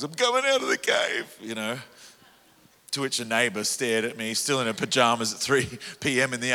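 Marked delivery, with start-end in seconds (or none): 0.0-1.3 high shouted cry; triumphant high yell. Retold or imitated. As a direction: yell it out to the world. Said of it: "yelling out at the top of my lungs".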